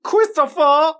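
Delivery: loud, high Boston yell of the name; Boston accent. Retold or imitated. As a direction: shout it in a thick Boston accent. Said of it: "doing it in, like, a thick Boston accent".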